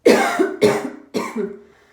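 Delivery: loud hacking cough; smoker's cough. Real, not acted. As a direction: cough hard, chesty.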